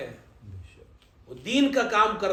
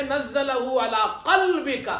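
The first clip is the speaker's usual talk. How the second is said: Quran recitation; 3 semitones higher, much slower than usual. Shown as a demonstration.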